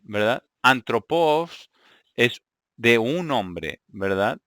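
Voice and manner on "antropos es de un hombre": "Antropos" drawn out, each syllable spaced; teacher repeats the Greek word slowly for the class; slow teaching demo. Shown as a demonstration. Teacher to Greek learners, slow careful "Antropos". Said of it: "antropos es un hombre".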